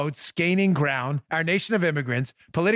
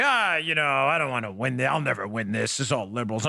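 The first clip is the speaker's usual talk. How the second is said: mock ref-working whine; 6 semitones lower than usual. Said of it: "when you work the refs and you say".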